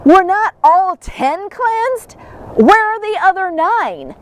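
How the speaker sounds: slow, grave questioning; Jesus asking where the other nine are, slow and grave. Retold or imitated. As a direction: ask it slowly, searching for the missing nine. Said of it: "Jesus asked".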